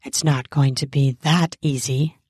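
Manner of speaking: low, teasing refusal. Acted. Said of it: "I teased".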